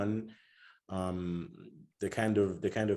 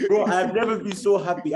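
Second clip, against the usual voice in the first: real laugh, high and loud; laughing with joy; 11 semitones higher, 7 dB louder than usual. Real, not acted. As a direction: laugh through the words, thrilled. Recalling Chelsea's win, laughing.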